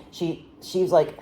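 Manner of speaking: plain reading voice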